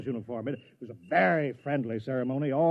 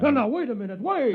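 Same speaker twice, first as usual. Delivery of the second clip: panicked pleading; 8 semitones higher, 4 dB louder than usual. Acted. Inspector panics at gunpoint.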